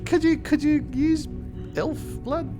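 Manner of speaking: usual speaking voice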